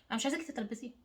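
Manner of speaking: normal talking voice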